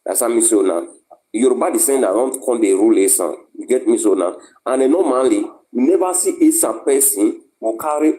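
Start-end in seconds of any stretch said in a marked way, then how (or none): none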